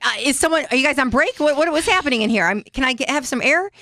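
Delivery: high, anxious stream of questions; mock panic. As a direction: act out getting antsy in the scanner. Acts out panicking inside the MRI.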